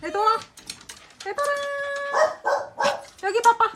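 loud high call to the dogs. Real, not acted. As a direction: call them over loudly. Calling the dogs.

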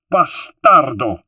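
loud, drawn-out angry curse; flung-back insult. A narrator's reading. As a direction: hurl the insult back across the water. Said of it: "she flung back".

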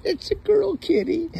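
cooing way up high, drawn out; high, slow pet talk. Real, not acted. Owner cooing slowly to her girl cat, high.

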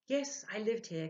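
lightly voiced spirit; spirit's answer. A narrator's reading. The spirit answering Ludwig, lightly voiced. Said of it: "came the answer".

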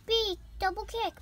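excited move call. Pretend. Calls move.

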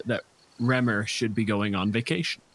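usual speaking voice

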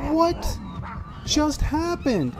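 high, loud, slow shocked question; shocked disbelief. Real, not acted. Player just attacked in the game, shocked. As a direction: cry out, stunned by what hit you.

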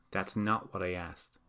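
ordinary delivery, nothing marked

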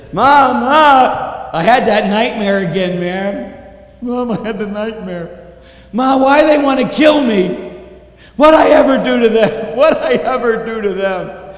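put-on high, loud panicked boy after a nightmare; frightened child. Boy waking from nightmare, crying out to mom. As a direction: plead like a scared kid. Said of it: "he says".

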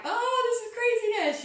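high, squeaky mock panic. To herself when feeling overwhelmed, a high mock-panicked squeak. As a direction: throw the line up high like you're overwhelmed. Imitating her own panic.

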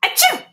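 high sneezing achoo; sneeze. Acted. Kitten sneezes.

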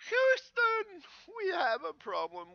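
high, loud mock distress call. Vlogger hailing Houston about a world gone wrong, calling out. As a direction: holler it out like an emergency call. Calls out the famous distress line.

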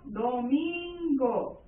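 "domingo" stretched into "do-min-go"; very slow, drawn-out syllables in "domingo". Shown as a demonstration. Teacher classifying the word for students, syllable by syllable. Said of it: "acá clasifico".

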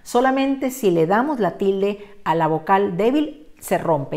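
ordinary talk, nothing marked

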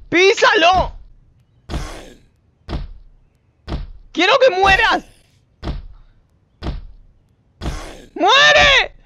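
high, loud raging shouts; furious yells. Real, not acted. Player fighting a zombie, furious shouting. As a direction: yell at the zombie to die.

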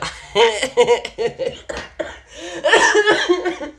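loud, high helpless laugh at a mental image; real laughter at a funny picture in the mind; real burst of laughter. Real, not acted. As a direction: crack up laughing at the scene in your head. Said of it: "I'm sorry that I'm laughing".

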